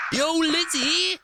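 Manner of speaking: high, drawn-out shout of greeting; excited call-out. Real, not acted. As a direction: stretch the name out like hailing a friend. Calling out to arriving viewer.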